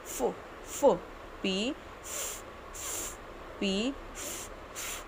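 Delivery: फ as a soft puff of air, almost whispered; soft breathy P. Shown as a demonstration. Teacher showing kids the P sound as a soft puff. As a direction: breathe out फ quietly between p and f. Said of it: "प और फ दोनों को mix करके आएगी".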